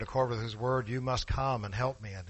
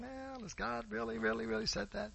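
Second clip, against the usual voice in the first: a higher, much softer sly tempter; Satan whispering; 11 semitones higher, 9 dB quieter than usual. Retold or imitated. Preacher voicing Satan tempting the faithful, soft and sly. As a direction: coax them like a tempter. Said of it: "Satan is whispering in our ear saying".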